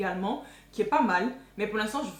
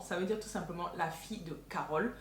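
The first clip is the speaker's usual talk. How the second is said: usual speaking voice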